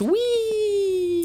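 high, drawn-out "whee"; playful ghost squeal. Pretend. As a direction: squeal like a gleeful little ghost. Playfully voicing Sunny the Ghost.

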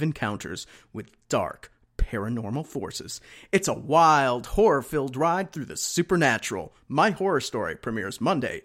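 hammed-up radio announcer promo. Host mocking a show blurb, put-on radio announcer voice. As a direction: read it like a dramatic horror trailer. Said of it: "Radio voice, radio voice".

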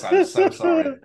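high apologetic squeal. Real, not acted. Apology after wonky intro.

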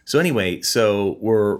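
ordinary delivery

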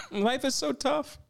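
sarcastic impression of online supporters, pitched high; mock sympathetic supporter. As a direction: coo it like a sarcastic fan patting her head. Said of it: "A lot of these people were like".

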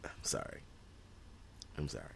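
hushed, sheepish apology; small, quiet sorry; meek apology. Real, not acted. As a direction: apologise very softly, as if just scolded. Apologises after being told to calm down.

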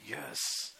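very soft, acted relish; hushed satisfied yes. Moviegoer hearing the promise of vengeance, hushed acted satisfaction. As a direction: savor it under your breath. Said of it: "we're like".

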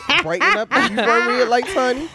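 high, loud teasing laugh. Real, not acted. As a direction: tease her while laughing.

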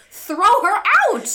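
high, loud, drawn-out shout; ranting full-voiced command; exasperated yell. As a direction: yell it like you're fed up with her. Ranting at the woman in film.

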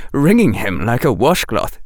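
usual speaking voice

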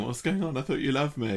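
high, pleading puppy voice; abandoned puppy. Retold or imitated. Host voicing John as an abandoned puppy, high and pleading.

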